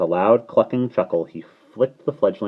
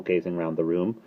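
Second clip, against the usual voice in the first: plain reading voice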